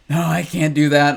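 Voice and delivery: mock cousin blurting a loud no; hammed-up stubborn refusal. Cousin refusing to text her, loud and stubborn.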